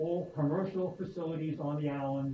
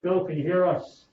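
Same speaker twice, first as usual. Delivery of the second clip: louder, slower call to someone far off; 2 semitones higher, 7 dB louder, slower than usual. Real, not acted. Calling to unheard remote member.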